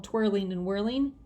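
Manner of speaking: ordinary delivery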